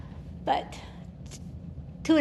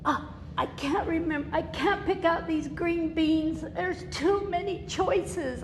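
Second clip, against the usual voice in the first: higher, slow, overwhelmed whine; helpless self; 6 semitones higher, 4 dB quieter than usual. Retold or imitated. Overwhelmed at the grocery store, higher helpless whine. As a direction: sound lost and overwhelmed. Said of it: "I would go".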